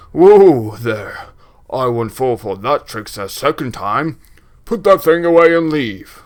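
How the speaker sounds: booming refusal with loud bursts. Acted. Braskin refuses the cursed box, booming. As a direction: boom out a warning to back off. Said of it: "doing the booming voice".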